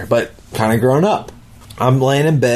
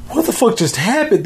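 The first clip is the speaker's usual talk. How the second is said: much higher, stunned outburst; shocked disbelief; 10 semitones higher than usual. Retold or imitated. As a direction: blurt it out just jolted awake. Waking up shocked from dream.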